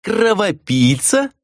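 high, drawn-out questioning echo; mock-incredulous repeat of her insult; amused mockery. A narrator's reading. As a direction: stretch the insult into a rising, teasing question. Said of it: "рассмеялся Миклаш и отвесил шутливый поклон".

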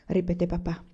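ordinary delivery

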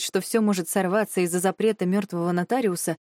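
ordinary delivery

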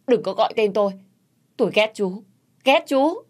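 high, loud, rising to a yell; angry shout. Acted. Wife shouting at her brother-in-law to stop, a rising yell. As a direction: push him away with your voice. Quỳnh rejects Huy angrily.

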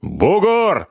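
high, forceful call; forceful command. A narrator's reading. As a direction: command him hard through the window. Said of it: "с напором командовал".

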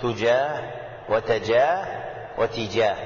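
"تجاه وتجاه وتجاه" as tujah, wa-tajah, wa-tijah; three vowels on ta. Shown as a demonstration. Teacher showing the three vowellings, "تجاه وتجاه وتجاه" as tujah, tajah, tijah. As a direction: space out "تجاه وتجاه وتجاه", first vowel u, then a, then i. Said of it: "الضم والفتح والكسر".

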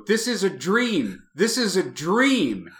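imitated wail of disbelief, not full volume; retold desperate cry. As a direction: cry it out slowly, stretching DREAM. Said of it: "just screaming stuff".

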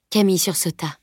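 ordinary delivery

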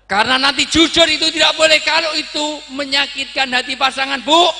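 high-pitched, full-force preacher's shout; shouted admonishment. Real, not acted. Preacher warns wives against hurtful honesty, shouted admonishment. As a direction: hammer the warning home, very loud.

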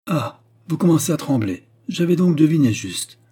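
high, loud triumphant accusation; accusing triumph. A narrator's reading. Queen triumphs over trembling cardinal.

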